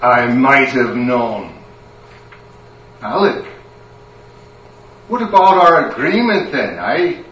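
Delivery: a stern wife with a Scottish lilt, rising into a scolding. Acted. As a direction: catch him out and scold him. Angry wife confronts Alec.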